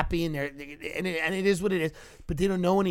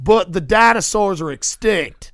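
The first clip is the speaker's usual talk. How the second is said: slow, loud deadpan Louisiana man; very serious; 3 semitones higher, 10 dB louder, slower than usual. Retold or imitated. Tour guest stating the obvious to the guide, slow and serious. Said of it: "the Louisiana man said very seriously".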